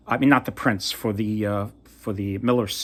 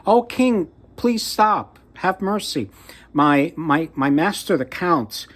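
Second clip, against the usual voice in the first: high-pitched cry for help, easing toward the end; mock screaming plea; 7 semitones higher, 3 dB louder than usual. A narrator's reading. Cat begging the passing king to stop, mock screaming. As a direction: call out urgently, acting a scream without full force. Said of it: "the cat screaming".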